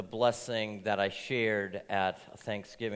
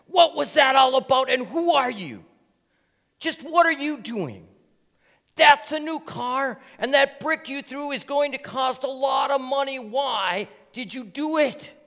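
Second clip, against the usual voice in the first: furious yelling, high and loud; an angry driver shouting at the boy over his car, much higher and louder; 14 semitones higher, 11 dB louder than usual. Acted. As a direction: yell at the kid who dented your new car.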